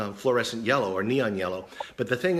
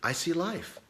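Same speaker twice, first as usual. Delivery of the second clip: hushed, higher-pitched, sincere declaration; 4 semitones higher, 6 dB quieter, slower than usual. Retold or imitated. Doctor looking at the patient over a handful of pills, sincere. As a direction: say it softly, with heartfelt sincerity. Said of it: "just with sincerity".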